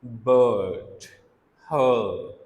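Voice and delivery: बर्ड and हर with a long vowel and no r; model words. Shown as a demonstration. Teacher repeats the words slowly for the class. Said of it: "आपकी टंग जो है वो कहाँ पर होगी?".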